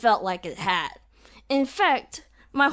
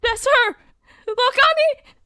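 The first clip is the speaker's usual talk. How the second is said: much higher, louder exclamation; excited shout, much higher; intended tone; 7 dB louder than usual. Shown as a demonstration. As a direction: point her out, thrilled. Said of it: "when I actually do the intended tone".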